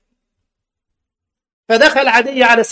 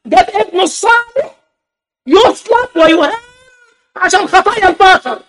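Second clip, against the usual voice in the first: much higher, louder, hammed-up pious preacher; mock preacher voice; 10 semitones higher, 6 dB louder than usual. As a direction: parody the church's solemn claim. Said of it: "قال". (صوت واعظ ساخر مرتفع جداً)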